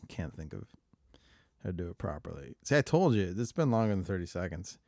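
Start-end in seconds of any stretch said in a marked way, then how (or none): none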